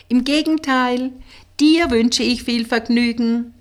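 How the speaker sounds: high, loud call; calling after someone. A narrator's reading. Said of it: "rief Suse ihr nach".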